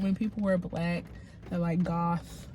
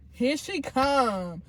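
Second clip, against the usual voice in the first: sighing, slow, higher-pitched dread; mock exasperation; 4 semitones higher, much slower than usual. House manager dreading the bubbly assistant, mock exasperation. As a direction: sigh and drag it out like you can't bear her. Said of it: "he's like".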